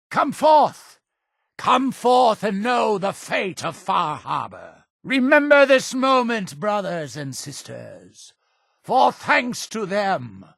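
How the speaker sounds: high, ringing call to the crowd; rallying proclamation. Acted. Leader calling brothers and sisters to come forth, high proclamation. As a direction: proclaim it like a leader summoning followers. Leader addresses the gathered faithful.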